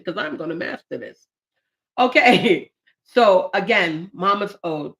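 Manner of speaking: normal talking voice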